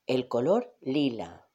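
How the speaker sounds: usual speaking voice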